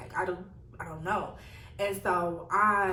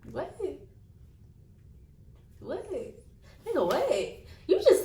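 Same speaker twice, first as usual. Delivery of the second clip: high, rising disbelief; incredulous; 8 semitones higher than usual. Retold or imitated. Woman reacting to being called Missy, incredulous. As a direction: squeak out a rising, baffled reaction.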